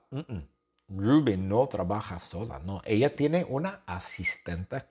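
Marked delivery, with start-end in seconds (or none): none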